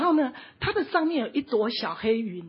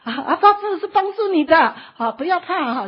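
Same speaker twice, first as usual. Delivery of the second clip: loud, raised shout on waking; still shouting; 5 semitones higher, 6 dB louder than usual. Retold or imitated. Servant still shouting after waking from the dream. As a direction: keep yelling the comfort as he wakes. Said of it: "还在喊说".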